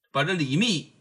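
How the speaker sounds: plain reading voice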